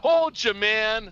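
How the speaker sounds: loud gloating cheer, pitched high; triumphant shout. Real, not acted. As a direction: yell it like you just won big. Gloating after the winning roll.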